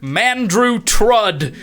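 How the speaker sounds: slow, grand name announcement; announcer voice. Acted. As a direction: call the name out like a host. GM announcing placings.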